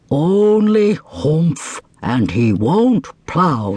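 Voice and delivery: very slow, plodding ox. Acted. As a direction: drag out the complaint. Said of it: "said the ox".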